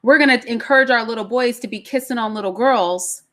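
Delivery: usual speaking voice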